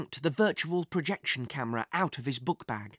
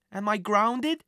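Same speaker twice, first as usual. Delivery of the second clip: a hint of a worried child, pitched higher; sheepish boy; 4 semitones higher, 3 dB louder, slower than usual. A narrator's reading. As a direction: ask timidly, in a lightly voiced boy's voice. Guilty boy asks his angry mother.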